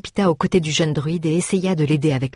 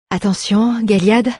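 higher, louder heads-up; warning; 5 semitones higher, 4 dB louder than usual. A narrator's reading. Young woman warns magistel while getting ready, raised warning. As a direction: warn him in a raised voice. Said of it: "préféra-t-elle prévenir".

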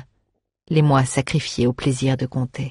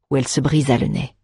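ordinary delivery, nothing marked

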